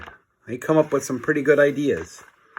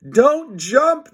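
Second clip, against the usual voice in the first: much higher, urgent plea; pleading cry; 14 semitones higher, 4 dB louder than usual. Acted. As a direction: beg him not to jump. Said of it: "they cried".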